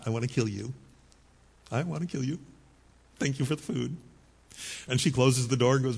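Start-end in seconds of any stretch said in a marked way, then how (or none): 0.1-4.0 a hint of quiet mock menace behind a smile; mom's inner thoughts. Son films sick mom at door, lightly voiced mock menace. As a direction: softly voice the mom thinking she wants to kill him. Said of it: "she's thinking".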